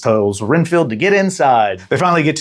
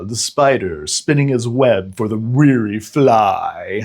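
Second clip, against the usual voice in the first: Dracula voice; 2 semitones lower, slower than usual. Retold or imitated. Imitating Dracula's line.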